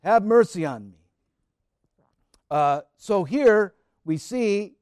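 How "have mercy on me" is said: louder pleading cry; raised plea for mercy. Acted. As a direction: call out to the Son of David. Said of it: "she cried out".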